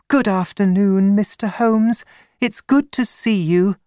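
high-pitched woman's voice; high, light character voice for a female visitor; young woman client, Miss Stoner. Acted.

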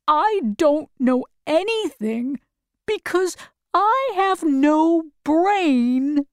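understated storybook sadness, slowing toward the end; sad scarecrow. A narrator's reading. Scarecrow admitting to Dorothy he has no brain, mildly sad. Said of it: "said the scarecrow sadly".

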